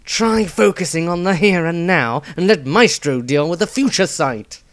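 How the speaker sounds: brusque warrior putting her in place; a gruff fighter barking at the girl; sharp retort. A narrator's reading. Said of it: "Dieter shot back".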